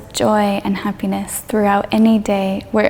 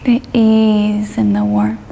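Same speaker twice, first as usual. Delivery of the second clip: guided meditation. Real, not acted. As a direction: ease them gently through it, drawing out the phrases.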